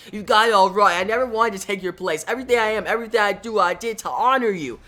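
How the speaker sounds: pleading shout. Acted. Wally shouts to Barry as the field closes, desperate. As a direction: shout your loyalty at him. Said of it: "Wally shouts".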